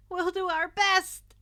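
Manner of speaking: high, loud fake-bright cheer; forced cheer. A narrator's reading. Mina faking enthusiasm before the exam, loud forced cheer. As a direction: pump it up without meaning it. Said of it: "obviously forced grin".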